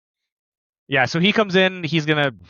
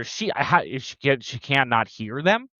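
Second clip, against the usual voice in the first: ordinary talk, nothing marked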